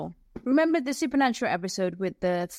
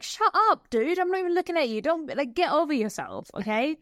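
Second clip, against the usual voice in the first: annoyed retort; 8 semitones higher than usual. Retold or imitated. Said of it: "I'm like".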